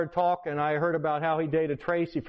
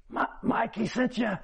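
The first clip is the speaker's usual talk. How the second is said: high, halting sick man's voice; dying man; 5 semitones higher than usual. Retold or imitated. Sick uncle answers the visiting pastor, high feeble voice. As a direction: weakly stammer it out, pitched up. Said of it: "He looked like he was dying".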